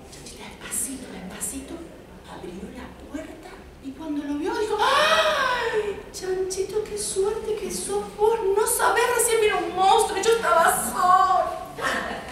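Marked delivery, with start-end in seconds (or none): 0.1-3.5 put-on hushed whisper for the kids. A narrator's reading. Storyteller telling children how Chanchita creeps, whispered. Narrating slow, quiet door opening. 4.8-12.2 high, slow, relieved little voice; relieved piglet. Acted. Chanchita relieved to see Chanchito, high and slow.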